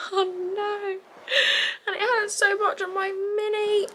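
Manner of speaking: high, tearful whimper of pain. Real, not acted. Mum wincing in pain while baby feeds, tearful whimper. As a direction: whine it out as the feed hurts.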